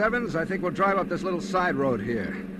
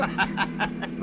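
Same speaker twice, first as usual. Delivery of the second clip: mocking laugh; 5 semitones higher than usual. Robber mocks her.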